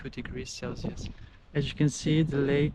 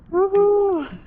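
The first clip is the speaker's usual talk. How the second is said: loud high woohoo; cheering whoop; 9 dB louder than usual. Real, not acted. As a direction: celebrate with a whoop. Cheering after the ice dip.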